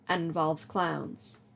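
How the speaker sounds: normal talking voice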